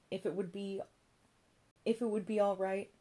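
tentative, with a halting restart; hesitant request. A narrator's reading. Charlie asking Joan to let him see his brother, hesitant. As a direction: break off, pause, then ask politely.

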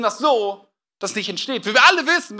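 normal talking voice

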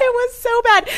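high, loud squeal of cringe; shrieking delight. Real, not acted. Gushing to her co-host about the awful scene, squealing. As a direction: squeal it like you can't bear it.

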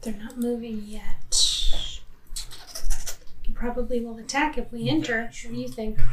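hushed whisper. Real, not acted. Whispering near the guardians.

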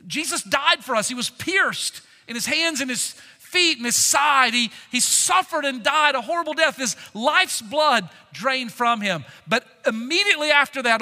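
full-force preaching. Acted. Pastor preaching to the church at full force. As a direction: preach it out to the congregation.

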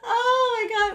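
high, laughing oh my God; breaking into laughter. Real, not acted. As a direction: crack up as you say it. Laughing at the memory.

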